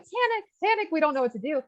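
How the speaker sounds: hammed-up alarm, then settling; a doctor's mock panic, jumping high then easing. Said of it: "every other doctor's like".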